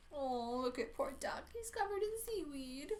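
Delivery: ordinary delivery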